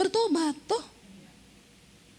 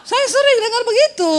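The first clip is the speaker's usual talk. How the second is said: high, louder laughing claim; 9 semitones higher, 5 dB louder than usual. Real, not acted. Preacher jokes she hears it often. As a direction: laugh while boasting.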